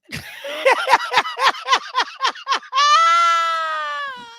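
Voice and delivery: high cackling fit ending in a long held note; long high laugh. Real, not acted. Host cracks up over board meeting joke, long high laugh. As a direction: laugh high, then stretch it out.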